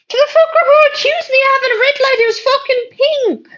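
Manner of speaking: fast, very high-pitched protest; indignant outburst. Real, not acted. Streamer answering accusation about a red light, indignant. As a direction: squeal it out, hugely affronted.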